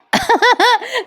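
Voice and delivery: short high burst of laughter; real laugh. Real, not acted. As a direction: laugh at the beard joke. Narrator laughs.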